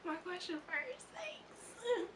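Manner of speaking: high, breathy, soft disbelief; stunned thanks. Retold or imitated. Fan stunned her question was picked, hushed and high. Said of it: "I was like".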